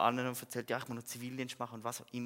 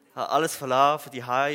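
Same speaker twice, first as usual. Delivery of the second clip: suddenly much louder on leaving everything; emphatic outburst; 13 dB louder than usual. Real, not acted. Preacher telling the church what he gave up, loudly stressed. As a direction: burst out with it, the big sacrifice. Testimony, dramatic turning point.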